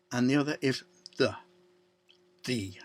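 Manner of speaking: the word "The" said as thee, stressed; emphatic THE. Shown as a demonstration. Preacher stressing "The" to his congregation. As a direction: lean hard on "The". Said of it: "as we'd say emphatically".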